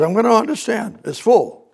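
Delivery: high mock voice of a know-it-all listener; mocking doubter. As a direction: squeak it out like a smug doubter.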